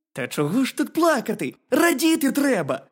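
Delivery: high, loud hedgehog voice; hedgehog character. A narrator's reading. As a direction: urge the bear as a lively little hedgehog.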